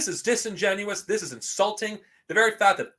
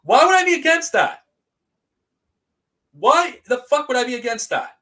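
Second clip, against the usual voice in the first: much higher and louder, indignant disbelief; outraged shout; 6 semitones higher, 6 dB louder than usual. Real, not acted. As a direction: yell it back at the people accusing you, stunned and furious. Accused streamer hits back at hosts.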